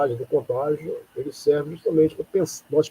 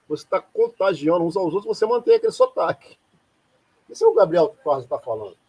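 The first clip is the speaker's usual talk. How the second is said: usual speaking voice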